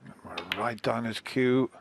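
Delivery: soft live snooker commentary; hushed commentary. Real, not acted. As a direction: murmur the play-by-play.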